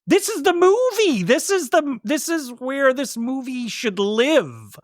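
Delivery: gushing with delight over the shot; excited gush. Real, not acted. Film fan gushing to his cohost about the best shot, excited.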